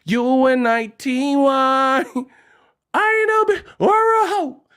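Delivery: high, outraged [name]; [name] impression. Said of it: "made me feel like [name]".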